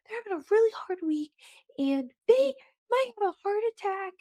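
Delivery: high-pitched, insistent defence; protective. Retold or imitated. Podcaster voicing her urge to defend the shopper, high protective voice. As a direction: plead their case in a high, pushy voice. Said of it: "The protective part of me".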